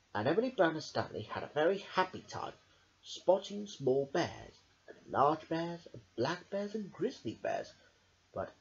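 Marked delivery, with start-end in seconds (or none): none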